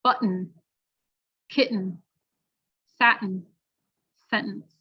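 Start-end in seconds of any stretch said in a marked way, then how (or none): 0.0-3.4 "Button" "kitten" "satin" with the T as a throat stop before a nasal N; American glottal T. Shown as a demonstration. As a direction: swallow the T in the throat. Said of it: "listen first".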